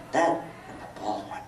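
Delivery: a low whispered aside, dropped out of the scene; quiet aside. Acted. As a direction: slip the line in under your breath.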